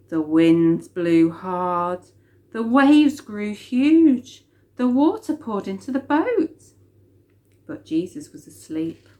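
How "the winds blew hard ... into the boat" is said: dramatic storm. A narrator's reading. Storm description, slow and loud.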